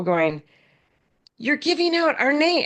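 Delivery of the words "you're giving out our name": much higher, thrilled imitation of a grateful caller; excited caller. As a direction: squeal it like happy news. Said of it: "they were really excited".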